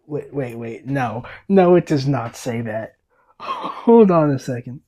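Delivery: ordinary delivery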